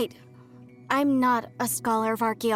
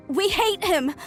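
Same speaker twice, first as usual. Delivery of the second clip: raised, pained declaration of hatred; bitter outburst; 7 semitones higher than usual. Acted. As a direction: burst out with long-held resentment. Bitter about murdered god.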